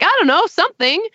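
high-pitched imitation of clueless critics; imitating critics reaching for anything; mock grasping guess. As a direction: toss it out high, as a helpless guess. Acts out critics grasping for buzz.

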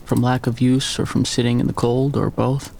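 usual speaking voice

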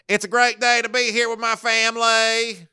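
mocking a coach's put-on Louisiana twang; mock Southern accent. Host mocking Kelly's fake Southern accent to listeners. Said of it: "the way that someone who's from the Northeast would say / The second time is".

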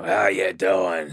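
slow, drawn-out New York impression; stretched-out New York greeting; Bulldog's New York accent. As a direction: mimic a buddy's New York drawl. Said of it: "she couldn't believe that voice".

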